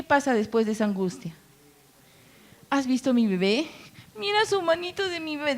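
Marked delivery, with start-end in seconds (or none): 2.7-5.6 cooing mother. Pretend. New mother admiring her baby.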